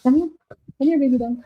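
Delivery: high coaxing pet voice; sweet cooing call. Real, not acted. Coaxing the dog.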